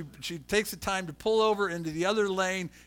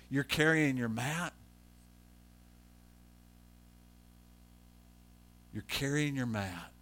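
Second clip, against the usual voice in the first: low, soft, deliberate repeat; subdued quoted reproach, dropping softer; hushed incredulity; 6 semitones lower, 6 dB quieter than usual. Retold or imitated. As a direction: let the petty complaint hang quietly. Critics' petty complaint, repeated incredulously.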